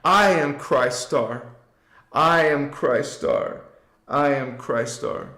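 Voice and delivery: slow chant that fades off on the last round; repeat-after-me affirmation. Shown as a demonstration. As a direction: repeat it slowly, letting it drift away. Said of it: "Say it with me".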